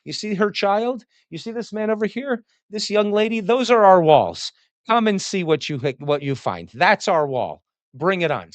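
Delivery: Leonidas. Retold or imitated. Leonidas showing visitors his people as walls, proud and defiant. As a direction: boast like a king daring invaders. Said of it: "Leonidas says".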